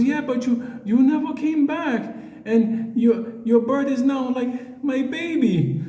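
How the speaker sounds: put-on high, plaintive old lady voice; clinging to her pet. Old lady refusing to give back the bird, high and plaintive.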